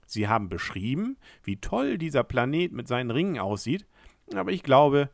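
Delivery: ordinary delivery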